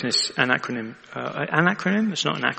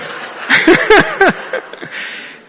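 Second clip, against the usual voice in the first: high laugh; 4 dB louder than usual. Real, not acted. Preacher laughs at the bus company's excuse, high laughter.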